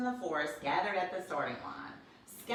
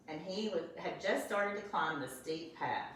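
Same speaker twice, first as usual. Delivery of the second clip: ordinary delivery, nothing marked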